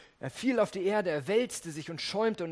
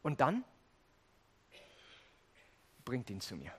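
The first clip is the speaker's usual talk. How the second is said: gentle invitation; 5 semitones lower, 7 dB quieter than usual. Retold or imitated. Jesus softens after rebuke.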